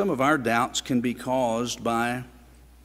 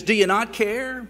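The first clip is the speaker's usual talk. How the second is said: frustrated Martha; 8 semitones higher than usual. Retold or imitated. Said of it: "Frustration with her circumstances led her to ask Jesus".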